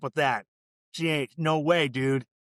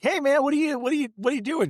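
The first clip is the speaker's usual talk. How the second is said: much higher, louder startled what are you doing; startled mock protest; 13 semitones higher, 4 dB louder than usual. DJ surprised to find the fan at his house, mock startled. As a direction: squeak it out like a guy caught off guard. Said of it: "she shows up, and he's like".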